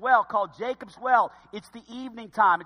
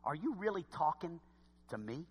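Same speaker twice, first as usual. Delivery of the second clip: soft, halting mock amazement; shocked disbelief; 2 semitones lower, 11 dB quieter than usual. Someone today shocked they're being spoken to, incredulous. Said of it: "who we are shocked they're speaking to us".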